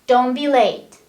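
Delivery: loud, blunt command; rude order. Shown as a demonstration. Teacher contrasts a loud rude order with a polite one. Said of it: "Let, let's see the difference".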